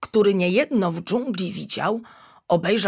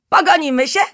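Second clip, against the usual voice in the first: bouncy, louder, higher question; cheerful invitation; 8 semitones higher, 8 dB louder, faster than usual. A narrator's reading. Little monkey invites the tiger to chase, bubbly and louder. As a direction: invite him to play with glee. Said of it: "podskoczyła wesoło".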